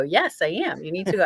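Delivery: ordinary talk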